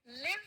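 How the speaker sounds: लिव with a short i, tune rising; short-i live. Shown as a demonstration. Teacher leading children to repeat, लिव with short i. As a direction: keep the vowel of लिव short, like "liv". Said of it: "बड़ी ई छोटी ई होता है".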